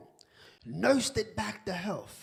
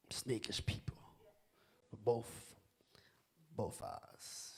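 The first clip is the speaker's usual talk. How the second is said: whispered warning; 11 semitones lower, 14 dB quieter than usual. Real, not acted. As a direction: whisper snakish people low and close. Preacher warns about snakes.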